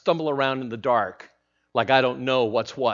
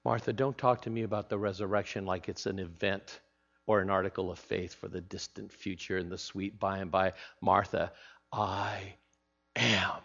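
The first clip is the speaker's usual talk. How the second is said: soft, intimate and earnest; intimate Jesus voice; 5 semitones lower, 10 dB quieter than usual. Acted. Jesus speaking softly to Martha, eye to eye. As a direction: hold her gaze and speak low.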